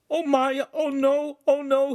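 high, loud panicked yelps; fearful. A narrator's reading. The enactor hops for the door, fearful. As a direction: panic as disaster approaches. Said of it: "he said with fear".

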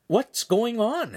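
high, slow, bewildered question; alarmed question. A narrator's reading. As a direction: ask in alarm, slowly. Confused boy asks in alarm.

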